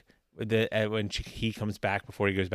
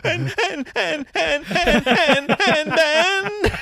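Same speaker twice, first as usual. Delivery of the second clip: mock sobbing stammer, much higher and louder; 7 dB louder, much slower than usual. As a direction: blubber the words like a hammed-up crier. Stammering, mock wailing sob.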